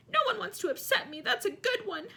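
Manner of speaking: high, loud ghostly howl. A narrator's reading. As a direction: howl it out, wounded. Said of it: "howled Myrtle".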